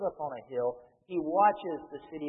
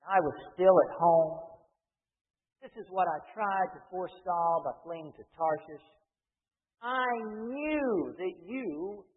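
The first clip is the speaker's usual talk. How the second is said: Jonah; 4 semitones higher, 5 dB louder than usual. Retold or imitated. Preacher voicing angry Jonah praying to the Lord, indignant complaint. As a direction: complain hotly to heaven, leaning on the words. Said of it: "He became angry. He prayed to the Lord".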